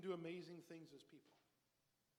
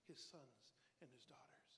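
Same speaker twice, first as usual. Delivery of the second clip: whisper; 6 semitones lower, 10 dB quieter than usual. Real, not acted. Sermon point dropped to whisper.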